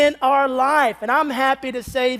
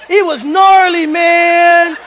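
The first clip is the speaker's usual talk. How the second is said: louder, higher bro shout on gnarly; mock surfer dude; 5 semitones higher, 9 dB louder, slower than usual. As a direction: ham up a hyped beach dude. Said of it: "a brother talking about".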